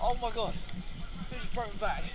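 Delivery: ordinary talk, nothing marked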